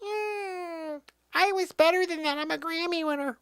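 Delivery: high, haughty put-on voice; teasing his sidekick in a high, haughty voice; mock Zabrosk. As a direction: voice him as a wounded diva. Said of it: "I know you're like".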